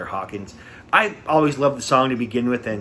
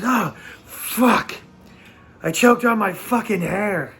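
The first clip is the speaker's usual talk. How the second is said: higher-pitched mock exasperated cursing; imitating startled singer; 8 semitones higher, slower than usual. Singer tells the crowd about his hair mishap, imitated exasperation. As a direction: gasp the oh, then curse in mock dismay. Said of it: "he goes".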